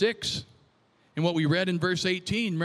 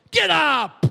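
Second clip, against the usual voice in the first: loud, high, sudden order; commanding shout; 6 semitones higher, 6 dB louder than usual. Acted. Preacher reads the Lord rousing Joshua, commanding shout. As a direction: rouse him with a shouted command.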